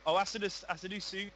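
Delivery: ordinary delivery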